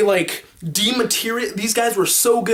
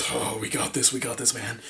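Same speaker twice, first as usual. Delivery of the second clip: soft, low hushed confidence; calm your buddy quietly while hiding; quiet cocky whisper; hushed reassurance; 7 semitones lower, 10 dB quieter than usual. Retold or imitated.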